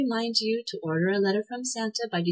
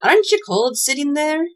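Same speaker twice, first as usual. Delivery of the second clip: high, loud, pert question; demanding; 8 semitones higher, 6 dB louder than usual. A narrator's reading. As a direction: demand an answer, pitched well up. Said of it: "demanded Tweedledee".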